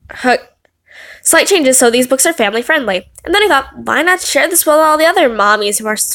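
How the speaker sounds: normal talking voice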